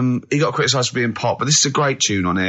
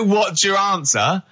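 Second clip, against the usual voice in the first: slow, higher-pitched mock of his reply; mocking quote; 6 semitones higher, slower than usual. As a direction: throw his question back at him, drawn out. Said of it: "You don't just go".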